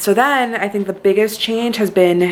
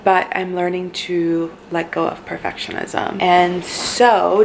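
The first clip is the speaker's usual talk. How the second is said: usual speaking voice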